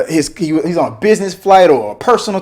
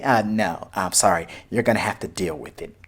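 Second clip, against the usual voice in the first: rude brush-off; 7 semitones lower, 8 dB quieter than usual. Retold or imitated. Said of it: "Maybe she said it like that".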